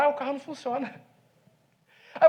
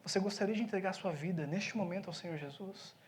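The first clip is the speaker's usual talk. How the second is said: lower, much softer aside; quiet confiding; 5 semitones lower, 12 dB quieter than usual. Retold or imitated. Pastor confiding in the pizza man, hushed. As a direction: lean in and murmur just to him.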